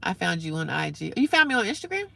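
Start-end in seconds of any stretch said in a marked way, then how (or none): 1.2-2.1 high, incredulous question; taken aback, much higher; surprised. Real, not acted. Surprised a viewer found her Instagram.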